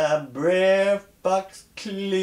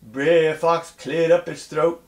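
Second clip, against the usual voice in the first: ordinary delivery, nothing marked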